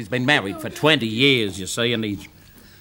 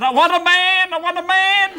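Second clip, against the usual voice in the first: very high, loud screaming wife; 5 dB louder than usual. Acted. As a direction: shriek it like a frantic wife. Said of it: "screaming her head off".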